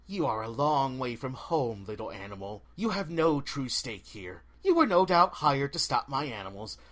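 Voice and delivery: cold, ancient forest spirit; haughty dryad. Acted. Dryad speaks at length.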